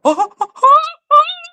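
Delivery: squealing laugh; high disbelieving laugh; high burst of laughter. Real, not acted. Laughs at the claim.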